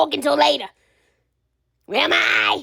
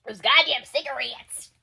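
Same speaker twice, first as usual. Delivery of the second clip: normal talking voice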